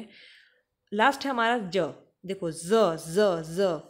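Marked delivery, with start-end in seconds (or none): 2.3-3.8 ज buzzed as a long z, very slow and louder; ज stretched into zzz for students; drawn-out sound demo. Shown as a demonstration. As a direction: hold the ज as a buzzing z. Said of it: "इसको ज कहते हैं".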